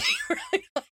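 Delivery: a sudden high burst of laughter. Real, not acted. Vet laughing at her own outspokenness, high laugh.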